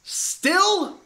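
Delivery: high, loud disbelief; can't believe it's still broken; sharp shocked squeak; incredulous. Acted. Captain shocked comms still dead.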